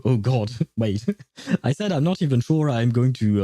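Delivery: ordinary delivery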